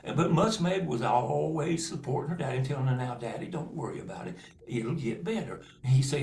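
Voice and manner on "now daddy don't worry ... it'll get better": comforting daughter. Acted. Girl comforting her poor father.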